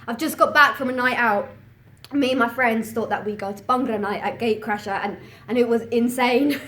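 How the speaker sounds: loud, buzzing recap; excited. Acted. Student just back from Bhangra night, loudly excited. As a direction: burst in full of the night out.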